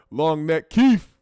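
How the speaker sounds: normal talking voice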